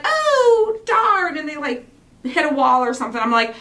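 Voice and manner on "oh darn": high, loud mock wail of frustration; hammed-up frustrated yelp; mock frustrated cry. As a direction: wail it like someone about to punch a wall. People hitting a wall.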